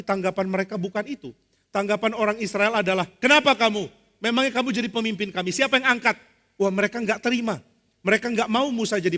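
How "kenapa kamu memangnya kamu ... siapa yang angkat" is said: raised, higher-pitched challenging questions; indignant challenge. Acted. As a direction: throw the challenge back at a would-be leader. Israelites rejecting Moses, acted. (menantang dengan nada kesal)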